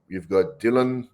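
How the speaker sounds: normal talking voice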